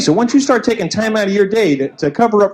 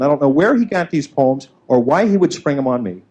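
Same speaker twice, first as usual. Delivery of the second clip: ordinary talk, nothing marked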